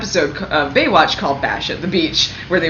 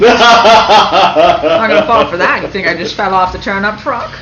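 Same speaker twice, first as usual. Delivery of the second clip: put-on old-timey voice; 4 dB louder than usual. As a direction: stretch it out like an old-time huckster. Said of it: "turn into, like, the Conan O'Brien old-timey voice".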